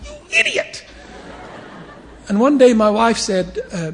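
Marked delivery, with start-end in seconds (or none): 0.1-0.8 very high laughing mock shout; mock angry yell. Bishop mimicking himself shouting at a driver, laughing. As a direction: yell it in fake outrage. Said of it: "I'd say".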